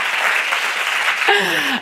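high rolling cackle. Real, not acted. Speaker asking applause for her cackle, high cackle. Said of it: "give it up for my cackle".

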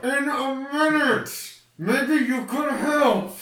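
high, slow shout while grappling; strained shout. Acted. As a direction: yell back, struggling. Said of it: "I just kinda go".

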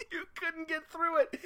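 very high, helpless laughing words; real laughing. Real, not acted. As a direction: squeeze the words out through laughter. Cracking up at cohost's blunder.

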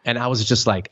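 ordinary talk, nothing marked

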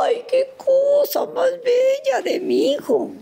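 high, slow tearful whimper; fake-sob over how sweet it is; hammed-up weepy child voice; mock crying. Mock sobbing over son's request.

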